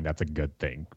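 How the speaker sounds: ordinary talk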